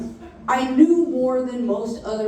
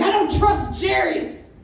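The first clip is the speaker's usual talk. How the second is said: a hint of a suspicious old man, pitched up; lightly voiced grandpa muttering distrust; grumpy grandpa; 8 semitones higher than usual. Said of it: "He's like".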